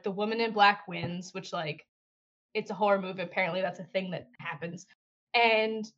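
usual speaking voice

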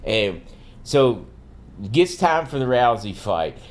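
ordinary delivery

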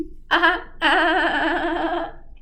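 high laughing fit at own joke; burst of laughter. Real, not acted. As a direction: crack up at the pun.

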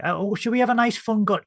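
high, chirpy mock question; mock admiral. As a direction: ham up a cheery officer. Admirals mocked in quote.

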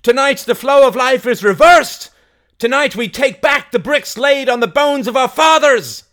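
high, loud rallying speech; Mary, fiery orator. Acted. Mary preaching revolt to the crowd, high loud oration. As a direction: declaim it to a crowd at full voice.

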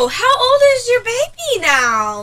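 high, slow, sing-song mock question; mimicking a nosy acquaintance. Friend mocking people who ask about her baby, high sing-song. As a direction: put on a syrupy fake-interested voice.

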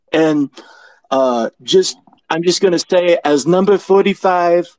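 mock presidential speech, pitched well above own voice; Donald. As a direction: impersonate a self-praising president endorsing with deliberate pauses. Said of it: "Thank you very much, Donald".

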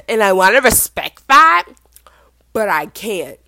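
loud, then a sudden whisper; mock earnest guy. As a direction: ham up the fake sincerity. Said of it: "This man says".

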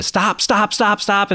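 high-pitched urgent mock call; frantic warning. Father waving at the boy backing into the fence, frantic warning.

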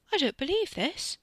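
high, stunned disbelief; disbelieving. A narrator's reading. Carrie stunned, open-mouthed.

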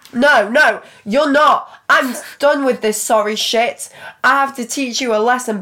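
loud menacing threat; threatening. Acted. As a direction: raise the voice, threatening. Boyfriend threatening her.

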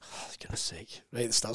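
whispered under the breath; hushed exasperated whisper; a whispered oh goodness sake; whispered dismay. Retold or imitated. Retold dismay.

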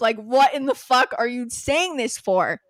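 loud exasperated rant, pitch up; blurting out disgust at him; angry outburst. Retold or imitated. Reliving her notes, an exasperated loud rant.

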